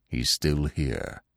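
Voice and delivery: soft, low and slow, hushed; whispered. A narrator's reading. Old stag warns Bambi the hunter is near, whispered. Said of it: "he whispered".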